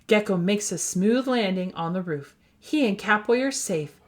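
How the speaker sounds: ordinary delivery, nothing marked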